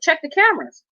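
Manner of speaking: high, pushy retold demand to the attendant; insistent demand. To the casino attendant, demanding he check the cameras, high and pushy. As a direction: press him hard, pitch jumping up.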